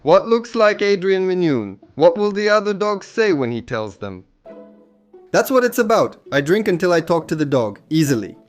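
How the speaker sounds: ordinary talk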